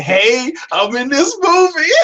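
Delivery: mock-enthusiastic, drawn-out announcement; cheery cameo entrance. Actor announcing a cameo in the movie, mock-cheery. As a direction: pop in brightly and stretch it out. Acting out a throwaway cameo role.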